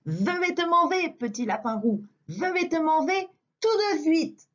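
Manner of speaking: wolf lisping je vais te manger with slushy s; lisping big bad wolf. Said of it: "un gros cheveu sur la langue comme toi". (loup qui zozote)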